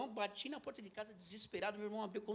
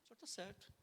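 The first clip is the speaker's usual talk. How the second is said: submissive reply; 9 dB quieter than usual. Retold or imitated. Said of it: "Ele falava, olha, falava, falava".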